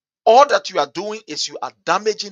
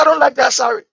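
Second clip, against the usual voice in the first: vexed quote; 11 semitones higher, 5 dB louder than usual. Retold or imitated. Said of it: "he can get vexed".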